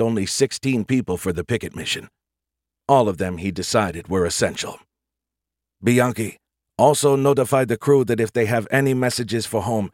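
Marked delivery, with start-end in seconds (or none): none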